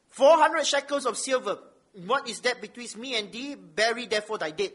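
measured public reading of holy text; scripture reading. Acted. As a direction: read it out firmly to the congregation, weighting key words. Reads scripture aloud.

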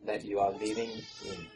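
fading into a real sob; quiet teary plea. Real, not acted. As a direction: let the question break with emotion. Preacher's emotional appeal.

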